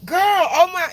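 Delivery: high, loud shocked exclamation; shocked gasp. Retold or imitated. When it happens to you, a loud high shocked cry. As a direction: cry it out in sudden dismay. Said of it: "and you be like".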